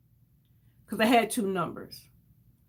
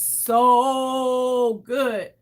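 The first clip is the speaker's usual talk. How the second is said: a long, stretched-out "so"; emphatic praise; 3 dB louder than usual. Real, not acted. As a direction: hold "so" long and strong before "good". Overcome praise, emphatic repeat.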